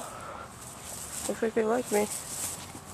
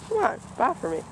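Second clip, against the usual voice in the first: ordinary talk, nothing marked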